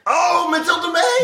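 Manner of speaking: high, drawn-out gleeful shout; excited shout. Real, not acted. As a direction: call her name out with delight. Excited cry of favourite.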